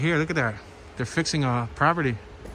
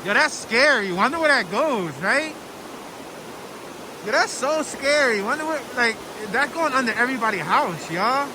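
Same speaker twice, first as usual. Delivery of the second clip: a light Philadelphia street voice, higher and freer; Philly voice; 7 semitones higher than usual. Real, not acted. Vlogger by the creek, showing off a light Philly accent. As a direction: lean into a Philly accent, casual and amused. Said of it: "Now I can talk in my Philly voice".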